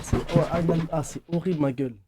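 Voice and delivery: normal talking voice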